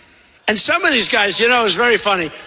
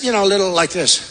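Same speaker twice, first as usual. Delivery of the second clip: ordinary delivery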